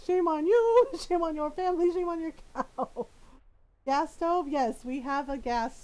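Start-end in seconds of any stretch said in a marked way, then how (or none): 0.1-3.1 loud, mock-outraged scolding. Mom mock-scolding her daughter over the burnt towel, loud and outraged. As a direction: scold her like a shamed auntie.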